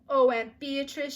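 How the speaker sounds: slow, loud, high cry of joy; delighted. A narrator's reading. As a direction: gush with delight. Delighted by the sewing box.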